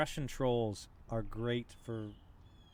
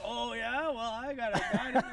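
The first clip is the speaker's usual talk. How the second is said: gloating troll; 11 semitones higher, 6 dB louder than usual. Pretend. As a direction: ham up a smug troll boasting over champagne. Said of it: "It's like".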